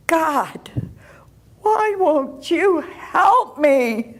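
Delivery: high, strained plea, drawn out; retold anguished cry. Pleading with God for help, retold anguished cry. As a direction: cry out slowly in a high voice, without real screaming. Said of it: "I just looked up and I screamed".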